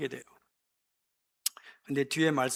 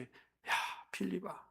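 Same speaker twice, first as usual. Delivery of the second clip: whispered, much softer 야, 빌립아; quiet annoyed whisper; 3 semitones higher, 11 dB quieter, slower than usual. Retold or imitated. Jesus quietly chiding Philip, whispered. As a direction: hiss it under the breath. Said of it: "이렇게 하셨을 것 같아".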